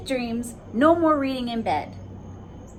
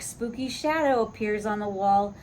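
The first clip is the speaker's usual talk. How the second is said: ordinary delivery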